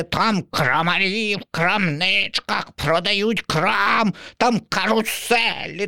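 high, slow enraptured crow; dreamy crow. Acted. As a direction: savour every word about Kraków. Crow praises Kraków.

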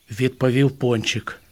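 ordinary delivery, nothing marked

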